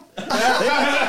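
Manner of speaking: loud high burst of laughter. Real, not acted. Telling his Swahili mix-up, high loud laugh. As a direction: laugh hard at the blunder. Big laugh at own story.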